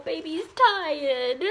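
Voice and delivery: slow, high cooing; doting pet talk. Real, not acted. Owner to tired puppy baby, doting coo.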